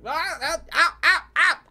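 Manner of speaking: very high, choppy, laughing goblin shrieks; goblin screams. Acted. Goblin yelping while dragged face first, half laughing. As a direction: yelp like a goblin bumped along the dirt.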